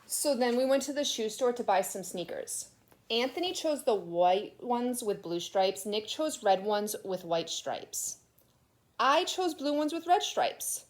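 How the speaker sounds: put-on lively read-aloud to children; storybook reading. A narrator's reading. Teacher reading a picture book aloud to her class, animated. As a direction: tell it like a kid's picture book.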